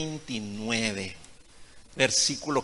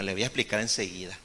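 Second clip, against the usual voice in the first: ordinary talk, nothing marked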